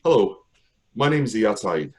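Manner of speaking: plain reading voice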